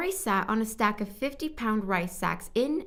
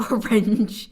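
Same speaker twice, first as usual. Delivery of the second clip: laughing; 10 dB louder than usual. Real, not acted. Laughs over a la orange.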